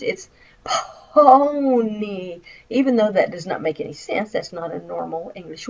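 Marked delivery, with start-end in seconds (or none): 0.6-2.4 phone read letter by letter as pony, slow; sounded-out mispronunciation. Shown as a demonstration. Child sounds out phone wrongly, very slowly. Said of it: "taught me to sound out words".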